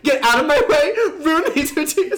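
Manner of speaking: mock urgent yell. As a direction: barrel past the captain shouting. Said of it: "I was like".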